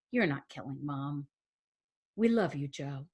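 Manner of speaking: low, soft fatherly comfort; tender reassurance. Acted. Dad tells his daughter she is loved, tender reassurance. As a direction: reassure gently, dropping almost to a hush.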